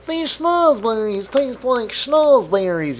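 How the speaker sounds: high, slow, drawn-out silly voice; put-on movie character. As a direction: stretch it out high and goofy.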